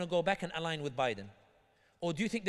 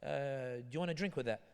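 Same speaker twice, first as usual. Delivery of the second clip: mock Japanese; 4 semitones lower, 5 dB quieter, much slower than usual. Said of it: "he'll be like".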